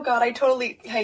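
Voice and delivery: ordinary talk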